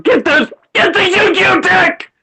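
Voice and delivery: high-pitched screamed command; furious order. Real, not acted. Angrily ordering friend to fetch cards.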